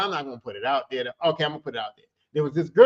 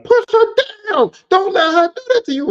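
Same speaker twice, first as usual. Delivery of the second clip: yelling; 9 dB louder than usual. Retold or imitated. Urging her brother to stop the dancer, loud high yell. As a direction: shout across to your brother.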